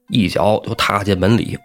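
plain reading voice

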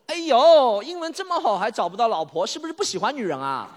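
loud high exclamation, easing down; hammed-up aunt needling her nephew; teasing aunt. (夸张地扮小姨高声调侃)

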